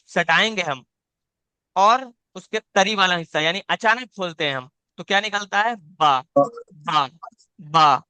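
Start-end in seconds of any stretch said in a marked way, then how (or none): 5.7-7.1 बा stretched very slowly and dragged, the way not to say it; drawn-out wrong बा. Shown as a demonstration. Teacher demonstrates a dragged बा that students should avoid. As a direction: drag बा out far too long. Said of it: "बा भी नहीं करना है".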